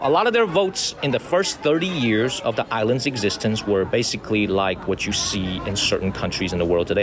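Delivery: ordinary talk, nothing marked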